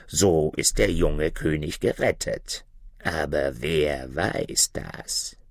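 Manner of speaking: prophesy doom like a dark bird; ominous raven. Acted. First raven foretelling the red horse to the others, low and ominous.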